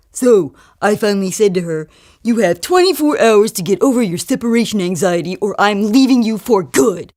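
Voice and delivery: put-on voice of a self-satisfied jerk; proud jerk. Jerk telling his date how he left his wife, proud. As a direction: boast like a jerk retelling his ultimatum. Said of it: "Here's Kelly on another date with another jerk".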